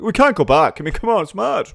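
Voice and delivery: hammed-up high-pitched protest; mock exasperation. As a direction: play the outraged progressive. Mocking progressives' objection.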